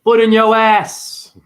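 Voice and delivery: loud, slow mock threat. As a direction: drag out a loud warning.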